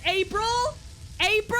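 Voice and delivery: can't believe the date; squeaky stunned asking; shocked disbelief. Real, not acted.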